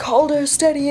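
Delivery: half-acted shout from the doctor; mock shout. Doctor barking orders at the boy, mock shout. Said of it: "Doc is probably shouting at him".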